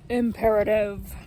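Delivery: teacher's demo of an order; commanding. Shown as a demonstration. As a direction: say it as a command to someone. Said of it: "telling someone to do something".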